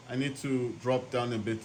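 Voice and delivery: normal talking voice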